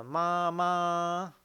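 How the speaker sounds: 妈妈 high, level and drawn out; first tone. Shown as a demonstration. Teacher giving a first-tone example, 妈妈 high and level. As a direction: hold both syllables of 妈妈 high and loud. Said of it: "第一声是咪".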